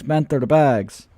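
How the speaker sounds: banter bags stretched out very slowly in the put-on accent; slow accented drawl. Streamer mocking rival's giveaway, slow drawn-out accent. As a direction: drag out each word, mocking. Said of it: "doing an accent on crypto banter".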